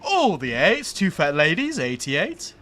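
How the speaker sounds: calling bingo numbers aloud, sing-song; loud bingo hall number call; bingo caller. Pretend. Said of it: "I would love to be a bingo caller".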